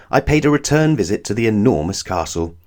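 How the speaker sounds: usual speaking voice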